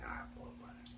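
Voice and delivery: whispered aside. Retold or imitated. Thinking to himself at stall.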